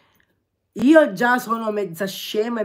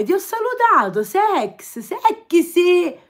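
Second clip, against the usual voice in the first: much higher and louder, playful; mock flirty chat voice; 9 semitones higher, 8 dB louder than usual. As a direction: camp it up, a cheeky "sexy". Reads flirty chat comment aloud. (voce civettuola esagerata, più acuta e forte)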